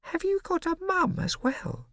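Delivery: put-on high, slow, wide-eyed kid; amazed little boy. A narrator's reading. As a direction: ask it in disbelief. Bo incredulous.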